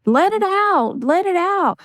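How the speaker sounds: high, coaxing push to let it out; encouraging cry. Retold or imitated. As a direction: coax them to let the tears come. Urging people to let tears out.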